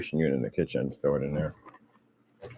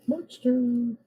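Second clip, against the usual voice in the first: high pet talk. Real, not acted. Baby-talking to the dog.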